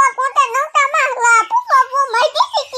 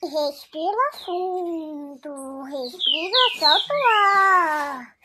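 softer, slow soothing coaching; calming; 5 semitones lower, 5 dB quieter than usual. Pretend. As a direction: talk her down gently, slowly. Son calming his mother down.

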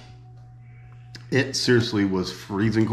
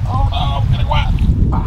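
alarmed; 8 dB louder than usual. Real, not acted. Bird bath blown over, alarm.

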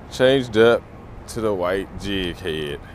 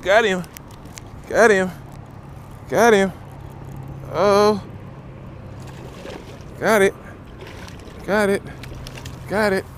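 excited shouting; 11 semitones higher than usual. Real, not acted. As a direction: yell it as the fish bites. Hooking a fish, shouting.

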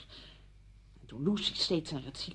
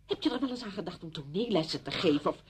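ordinary delivery

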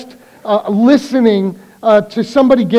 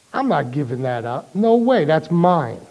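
mock stubborn tyrant; 6 semitones lower, 7 dB quieter than usual. Voicing the stubborn dictator.

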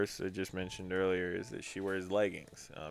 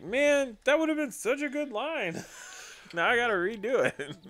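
mock frustration; 5 dB louder than usual. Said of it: "you're mad 'cause you're like".

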